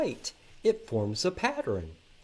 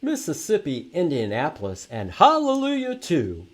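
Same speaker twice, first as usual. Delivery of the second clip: put-on loud, lively read-aloud for kids; reading to children; 6 dB louder than usual. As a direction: read the rhymes out big to the class. Reads picture book aloud.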